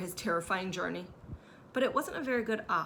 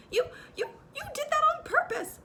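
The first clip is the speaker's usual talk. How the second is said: much higher, louder stammered accusation; spluttering Piglet; 6 dB louder than usual. A narrator's reading. Piglet spluttering at Kanga, much higher and louder. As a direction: splutter through soap. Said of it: "spluttered Piglet".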